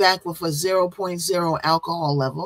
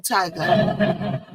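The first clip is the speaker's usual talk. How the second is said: ordinary delivery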